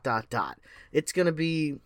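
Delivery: normal talking voice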